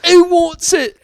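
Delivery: high, loud East Tennessee taunt; East Tennessee yell. Retold or imitated. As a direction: shout it like an East Tennessee local. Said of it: "East Tennessee version of it".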